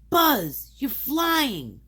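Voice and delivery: high, loud cry of amazement; excited cry. A narrator's reading. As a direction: call out in thrilled disbelief. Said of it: "Woody cried".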